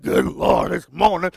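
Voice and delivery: low, groggy and grumbling; mock groggy groan. Preacher mocks the bleary-eyed morning grumble. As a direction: drag it out like you just woke up. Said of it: "walk around bleary-eyed".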